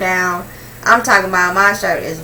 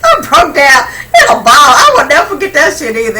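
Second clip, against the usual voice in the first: louder, laughing and crying as she tells it; laughing through tears; 6 semitones higher, 7 dB louder than usual. Real, not acted. Telling friends about crying over no sugar, laughing and crying. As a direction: crack up while half-sobbing the memory. Recalling sobbing over cereal.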